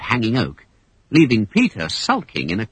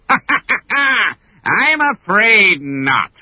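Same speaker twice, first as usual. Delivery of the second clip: very slow, higher villain sneer; 10 semitones higher, 3 dB louder, much slower than usual. Acted. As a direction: relish the bad news. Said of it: "sneered Captain Hook".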